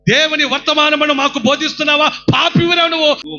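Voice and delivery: loud, high scolding shout; angry rebuke. Acted. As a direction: shout him down like an angry elder. Pharisees rebuking blind man.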